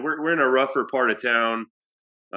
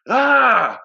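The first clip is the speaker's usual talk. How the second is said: a loud, high "Ah!" yell; a homeless man's loud, high yell in retelling; retold yell; 12 semitones higher, 10 dB louder than usual. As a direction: belt out a sudden angry yell. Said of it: "he's, like, yelling at me".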